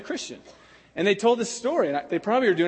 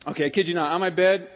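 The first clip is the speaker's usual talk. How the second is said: normal talking voice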